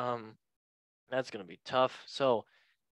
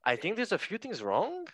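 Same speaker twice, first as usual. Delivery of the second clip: badly faked Scouse with questioning swoops; Jamie Carragher; 5 semitones higher than usual. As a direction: attempt Scouse, lilting up like a question. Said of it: "sound like a question as a scout".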